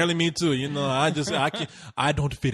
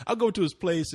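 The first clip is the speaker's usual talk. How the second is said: ordinary talk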